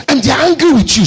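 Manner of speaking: loud, high repeat of the line; shouted. Real, not acted. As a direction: hurl it at the crowd. Same words repeated, now shouted.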